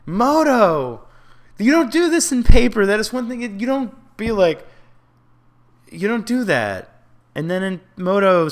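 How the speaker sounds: loud, high scolding of the game; angry rant. Real, not acted. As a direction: tell the program off at the top of your voice. Ranting at the game client.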